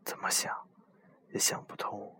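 hushed ghost-story whisper; breathy spooky campfire whisper; whispered storytelling. Acted. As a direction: tell it like a secret at night.

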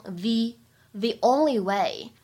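"the" said as thee before "only"; slow demo of "the only way" with the said as thee. Shown as a demonstration. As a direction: stress "the" with a long ee before "only way". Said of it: "定冠词需要重读".

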